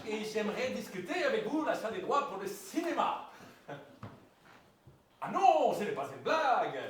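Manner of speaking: hammed-up Belgian producer on the phone; mock Belgian accent. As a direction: fake Belgian accent, lightly put on. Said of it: "Je prends l'accent belge". (faux accent belge de producteur au téléphone)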